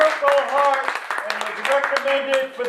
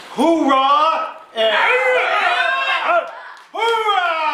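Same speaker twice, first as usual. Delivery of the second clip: Marine cheer; 7 semitones higher, slower than usual. Real, not acted.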